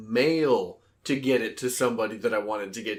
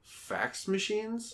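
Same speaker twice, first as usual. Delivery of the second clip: incredulous; 8 semitones higher, 8 dB quieter, much slower than usual. Real, not acted. Disbelief at hating fax machines.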